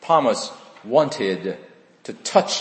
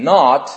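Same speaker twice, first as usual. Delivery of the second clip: "not" hit louder as the capital one; uppercase not; 6 dB louder than usual. Shown as a demonstration. Preacher showing the Greek's capital not, louder. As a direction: punch "not" as the big one.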